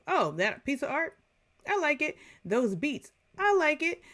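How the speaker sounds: high-pitched mock-naive approval; light, simple approval. Music fan acting out face-value liking, high simple approval.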